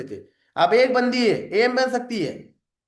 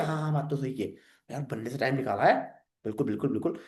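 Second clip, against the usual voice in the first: a much lower, softer, relaxed boss voice; senior sir; 9 semitones lower, 8 dB quieter, faster than usual. Acted. Senior mentor agreeing with the recruit in a low, quiet, easy voice.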